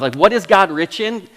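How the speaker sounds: ordinary talk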